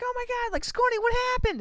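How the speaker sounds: high-pitched panicked cry; mock alarm. Player checking on flattened teammate, mock alarmed cry. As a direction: call out in hammed-up worry.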